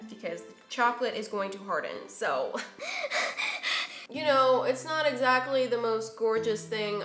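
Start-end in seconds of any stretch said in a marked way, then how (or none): 2.6-4.1 airy, high-ending laugh; mostly voiceless giggle. Real, not acted. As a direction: breathe out a laugh. Laughing at her ugly decorated cone.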